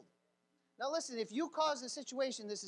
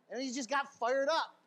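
loud, high, worked-up delivery; excited preacher building to a shout; fired-up preaching; 6 semitones higher, 9 dB louder than usual. Acted. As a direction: get more fired up as it goes. Acts out getting fired up.

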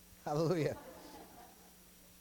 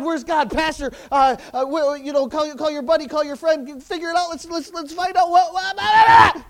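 fast, rising, louder and louder panicked babble; mock panic; 4 semitones higher, 10 dB louder than usual. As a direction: act out someone spiralling into panic, building to a shout. Acting out a panicking worrier.

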